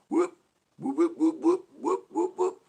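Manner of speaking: rising high loud siren whoops. Retold or imitated. Complaining about police sirens, loud whooping imitation. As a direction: whoop it like a cop siren, climbing. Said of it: "Why do you have to do this whole".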